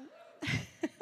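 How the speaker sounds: a soft unvoiced giggle; breathy laugh. Real, not acted. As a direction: giggle under your breath. Wife laughs again.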